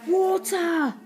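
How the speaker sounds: high cry of sudden realisation; acted eureka shout; exclaiming. A narrator's reading. Boy realises what cats fear, exclaiming. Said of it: "exclaimed the boy".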